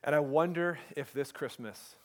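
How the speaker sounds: normal talking voice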